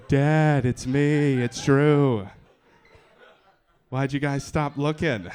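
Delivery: put-on loud, plaintive kid calling his dad; long-lost son on the front porch; kidnapped kid. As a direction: call out hurt that they stopped looking. Kidnapped kid returns home.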